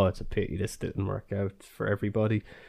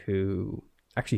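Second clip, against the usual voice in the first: ordinary delivery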